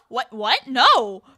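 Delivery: loud, acted-out surprise; mock disbelief. Friends hearing about the fire alarm, loud mock disbelief. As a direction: act out a baffled, emphatic denial.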